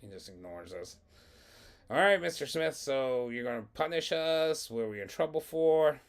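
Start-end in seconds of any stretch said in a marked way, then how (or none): 1.9-6.0 higher, louder needling questions; cheeky student. Retold or imitated. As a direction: prod the silent principal, voice pitched up. Cheeky student prodding silent principal.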